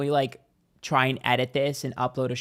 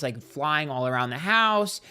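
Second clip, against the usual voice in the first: usual speaking voice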